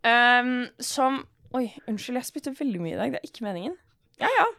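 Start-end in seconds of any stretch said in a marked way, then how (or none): none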